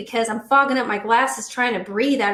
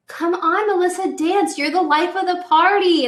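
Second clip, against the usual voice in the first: put-on pushy party girl; 7 semitones higher, 4 dB louder than usual. Imitating girl urging her to dance.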